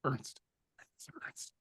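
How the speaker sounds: a name murmured across the room; distant murmur. Retold or imitated. Overheard name from across studio.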